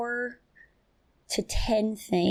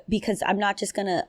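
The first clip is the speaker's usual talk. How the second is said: usual speaking voice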